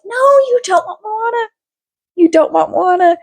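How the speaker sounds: high, whiny mock insistence; hammed-up high wheedling; manipulative coaxing. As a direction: talk the kids out of it in a high pleading voice. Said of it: "me manipulating these kids".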